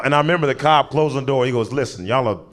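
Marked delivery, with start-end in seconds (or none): none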